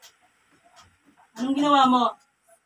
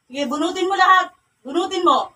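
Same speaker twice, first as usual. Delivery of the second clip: high, loud order; commanding; 5 semitones higher, 4 dB louder than usual. Real, not acted. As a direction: command it with a raised voice. Orders the spirit to pull out. (mataas at malakas na utos)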